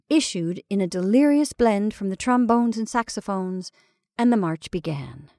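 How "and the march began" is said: ordinary delivery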